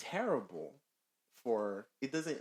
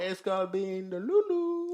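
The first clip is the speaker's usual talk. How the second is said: squealed label; 11 semitones higher, 11 dB louder than usual. Real, not acted. Teasing coined phrase.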